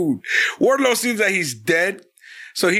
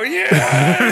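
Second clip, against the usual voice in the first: cheering crowd; 2 semitones higher, 4 dB louder than usual. Retold or imitated. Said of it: "and they go".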